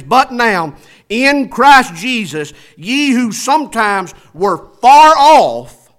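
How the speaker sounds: loud, slow, high pulpit reading; preaching scripture. Acted. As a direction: proclaim each phrase slowly from the pulpit. Scripture read at full force.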